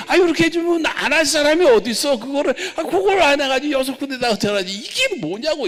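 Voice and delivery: high-pitched angry scolding, retold from the pulpit. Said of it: "이거를 막 야단치고".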